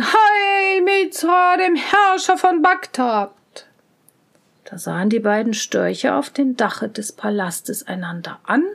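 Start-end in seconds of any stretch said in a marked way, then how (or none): 0.0-3.3 high, slow cheering crowd call; crowd shouting. A narrator's reading. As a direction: hail the ruler like a crowd. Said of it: "und alle schrien".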